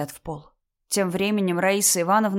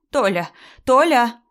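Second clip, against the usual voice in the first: loud call, second higher and louder; shouting a name; 5 semitones higher, 8 dB louder, slower than usual. A narrator's reading. Mother-in-law summoning her husband, shouting. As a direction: call urgently across the flat. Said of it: "кричала Раиса Ивановна".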